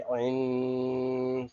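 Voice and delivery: आइन held long with a nasal hum; slow nasalised आइन; nasal ghunna. Shown as a demonstration. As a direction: stretch आइन slowly through the nose. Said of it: "तनवीन में गुना करेंगे".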